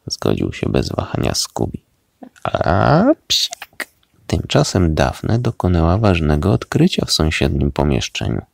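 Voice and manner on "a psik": high cartoon-dog sneeze; a sudden squeaky sneeze; acted sneeze. A narrator's reading. Second character sneezes. (wysokie, psie kichnięcie)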